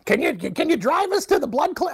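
high, calling mom voice; imitating his mom. Son showing how his mom really talks, high. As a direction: call his name up high like his mother. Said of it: "that's how she talks".

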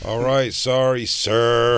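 playful scolded customer, high and slow; mock apology. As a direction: draw out a hammed-up sorry. Playing the scolded customer answering back.

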